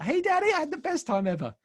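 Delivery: put-on high, quick, excited child voice; happy little daughter. Daughter telling her dad about kids' church, bubbling with happiness. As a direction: imitate a thrilled small girl telling Daddy. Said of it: "She was so happy".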